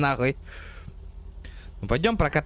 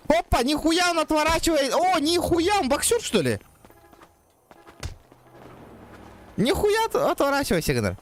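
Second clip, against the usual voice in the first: excited shouting; 10 semitones higher than usual. Real, not acted. Fight breaks out in game.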